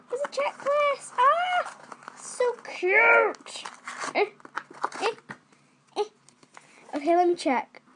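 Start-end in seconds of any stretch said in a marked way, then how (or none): 0.1-6.0 high, bright squeal; excited squeal. Real, not acted. Spotting the checklist for viewers, a high excited squeal. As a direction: delight at finally finding it.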